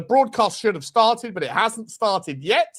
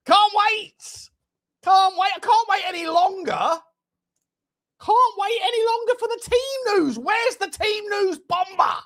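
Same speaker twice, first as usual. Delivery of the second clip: impatient excitement; 11 semitones higher than usual. Real, not acted.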